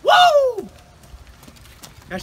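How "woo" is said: high, explosive "woo"; loud whoop. Real, not acted. Reacting to the hottest pepper, high loud whoop. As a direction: whoop loudly as the burn hits.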